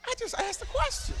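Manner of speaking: squeaky high innocent whine; voice taken way up high. Shown as a demonstration. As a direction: push it up high like you're really in it. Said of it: "You gotta take your voice up high".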